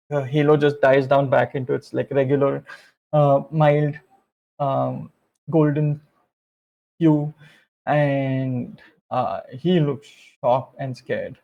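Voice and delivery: ordinary delivery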